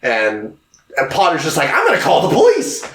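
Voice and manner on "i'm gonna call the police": high, quick imitation of Potter; hammed-up threatening villain; mock threat. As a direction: rattle it off high and fast.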